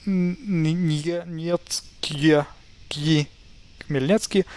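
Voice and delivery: mock struggle with "Khmelnytsky", long pauses between syllables; struggling to pronounce. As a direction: sound out "Khmelnytsky" haltingly, piece by piece. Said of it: "see you struggle".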